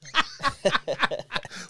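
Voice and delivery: high, bubbling laugh; real laugh. Real, not acted. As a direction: laugh at your own joke. Laughs at his dancing joke.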